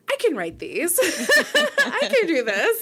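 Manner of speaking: laughing, high and loud burst of can-do; excited self-quote. Retold or imitated. Author recalling her first reaction to the books, excited and laughing. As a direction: exclaim it brightly through laughter, pitch leaping up. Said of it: "be like".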